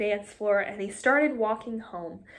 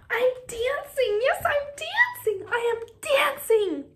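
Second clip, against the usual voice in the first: much higher, overjoyed exclaiming; joyful cry; 13 semitones higher than usual. Acted. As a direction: burst out with delight. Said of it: "Gerald cried".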